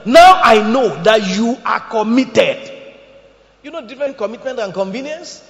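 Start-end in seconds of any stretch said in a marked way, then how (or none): 0.0-2.7 slow, booming divine voice, much louder; God declaring it with slow, heavy force. Acted. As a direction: speak as God, weighty and slow. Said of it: "like he said to Abraham".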